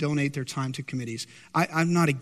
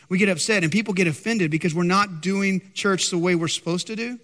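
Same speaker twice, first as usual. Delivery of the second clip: ordinary talk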